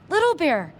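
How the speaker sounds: high, loud excited call; shouting. Acted. Boy shouts the Indian's name, thrilled high call. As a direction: call him out loud with joy. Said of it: "he shouted".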